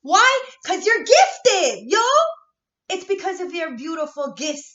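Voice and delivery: very loud, high hype yell; shouting. Real, not acted. To her viewers about their gifts, shouting loud. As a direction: hype the viewers up at full volume.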